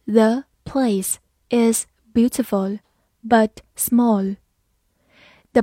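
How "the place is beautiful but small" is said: slow, each word set apart; slow reading. Shown as a demonstration. Teacher leads learners through the example, slow and separated. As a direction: read slowly with small gaps between words. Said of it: "慢慢来读".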